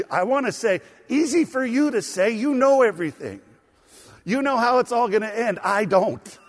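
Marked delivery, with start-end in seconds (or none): 1.1-6.4 louder, higher cheeky talk-back; smart mouthing. Pretend. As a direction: sass back at Jesus, half joking. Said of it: "this would be smart mouthing".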